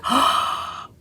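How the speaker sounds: sharp gasp of delight; happy intake of breath. Retold or imitated. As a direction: gasp as if the card just flipped. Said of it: "I'm like".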